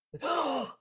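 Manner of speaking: sharp, high intake of breath; startled gasp. Retold or imitated. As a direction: re-enact jolting awake with a gasp. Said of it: "like a breath".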